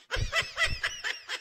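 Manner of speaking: exaggerated mocking cackle; mocking laugh shout. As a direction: cackle at them. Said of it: "This is how we sound".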